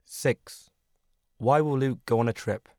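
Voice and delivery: usual speaking voice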